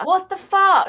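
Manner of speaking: high, loud shocked outburst; outraged disbelief. Real, not acted. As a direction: blurt it in disbelief. Outraged at boyfriend's message.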